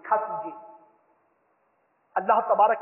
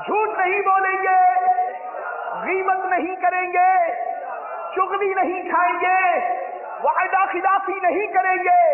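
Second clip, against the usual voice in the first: high, loud call for the crowd to repeat; loud pledge lead; 9 semitones higher, 5 dB louder than usual. Acted. Preacher leading the audience in loud pledges. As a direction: drive each vow home loudly. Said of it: "सारे बोलिए".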